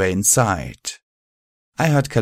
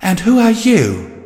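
much higher, slow, loud; Velvet voice; 11 semitones higher, 7 dB louder than usual. A narrator's reading. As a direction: call down slowly and clearly.

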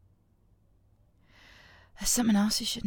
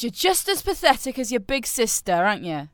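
ordinary talk

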